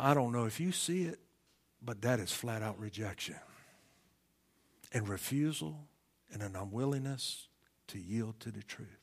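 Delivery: normal talking voice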